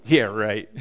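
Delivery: low, deadpan disbelief; sarcastic. Retold or imitated. Listener doubting the servant's promise, low sarcastic scoff. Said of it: "You go".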